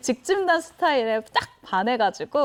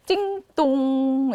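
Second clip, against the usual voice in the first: drawn-out ding-dong; slow sing-song doorbell sound; doorbell imitation; much slower than usual. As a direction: imitate a doorbell chime, slowly. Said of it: "이렇게 눌렀어요".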